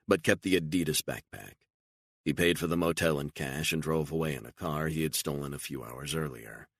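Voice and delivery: ordinary delivery, nothing marked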